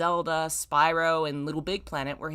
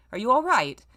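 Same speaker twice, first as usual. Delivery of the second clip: rough tenor; 6 semitones higher, 6 dB louder than usual. A narrator's reading. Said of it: "came the rough tenor near his ear".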